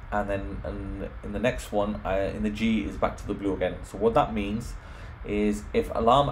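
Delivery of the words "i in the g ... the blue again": normal talking voice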